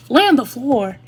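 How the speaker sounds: loud exasperated echo; incredulous. Real, not acted. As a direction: throw the words back in disbelief. Repeats nurse's absurd order.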